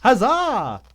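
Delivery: high, loud hammed-up huzzah; mock cheer. Friend cheering Lauren's wedding in jest, loud mock cheer. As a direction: crow it like a playful herald.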